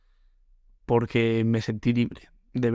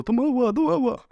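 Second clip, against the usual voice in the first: high shouted chant; mock yell; 12 semitones higher than usual. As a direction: yell it like a splashing ape. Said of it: "había gritado".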